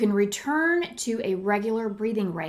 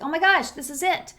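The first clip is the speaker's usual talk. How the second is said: light up as it clicks; excited discovery; 8 semitones higher, faster than usual. Retold or imitated. Reading a book and realizing, excited eureka. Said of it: "And I was like".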